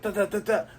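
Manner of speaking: high, quick "da, da" bursts; mock fight noises. Luffy pummeling Buggy, high mock fight noises. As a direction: rattle off punches like a cartoon. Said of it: "Luffy's like".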